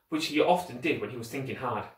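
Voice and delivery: ordinary delivery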